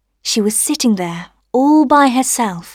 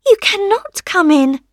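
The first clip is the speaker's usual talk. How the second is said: high little rose voice; rosebud refusing; 9 semitones higher, 4 dB louder than usual. A narrator's reading. Rosebud keeping sunshine out, high refusal.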